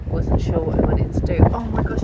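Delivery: ordinary talk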